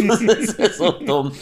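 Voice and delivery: slow, laughing through words. Real, not acted. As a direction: crack up while saying it. Cracking up laughing.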